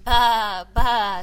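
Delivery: sheep bleating. Acted. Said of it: "said Sheep".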